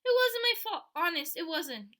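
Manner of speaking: loud, high panicked denial; high loud frightened protest; upset pleading. A narrator's reading. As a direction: plead your innocence, upset. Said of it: "Sheila cried".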